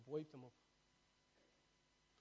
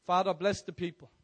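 loud prayer call; 4 semitones higher, 15 dB louder than usual. Acted. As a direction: lift the prayer up loudly. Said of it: "I'm just gonna pray".

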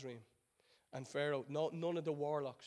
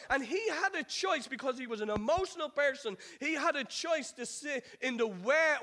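high, driving sermon delivery; impassioned preaching; 10 semitones higher, 9 dB louder than usual. Acted. Preacher urging the congregation through Joseph's journey, impassioned. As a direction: preach it with rising and falling emphatic waves.

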